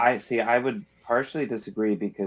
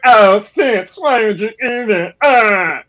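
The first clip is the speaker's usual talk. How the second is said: loud, high, whining mock wail; pretentious audiobook voice; 11 semitones higher, 10 dB louder, slower than usual. Reader mocks the audiobook narrator's Ketricken, hammed-up pretentious wail. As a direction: overblown wail, much higher and louder. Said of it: "stupid, like, pretentious accent".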